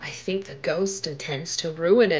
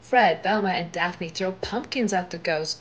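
plain reading voice

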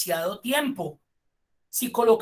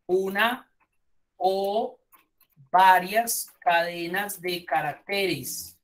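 word by word, with long pauses; deliberate emphasis; much slower than usual. Shown as a demonstration. Teacher defining what the asterisk replaces, slow deliberate emphasis. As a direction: set each word apart, slowly, for the class.

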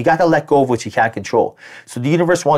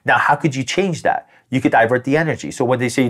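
usual speaking voice

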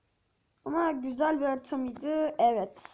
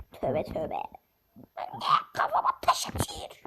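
silly put-on voice; 10 semitones lower than usual. Mock voice complaining about rocks.

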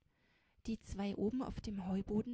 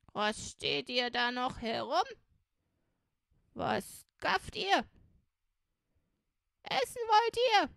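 put-on loud, high-pitched cranky old robber woman; scolding old woman; 5 semitones higher, 9 dB louder than usual. A narrator's reading. Old woman scolds the hungry beggars, loud and cantankerous. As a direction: berate them with rising, indignant outbursts.

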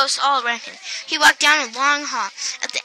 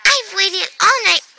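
higher, lightly voiced old lady; a hint of a kindly old woman; 6 semitones higher than usual. A narrator's reading. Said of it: "said Miss Tibbles".

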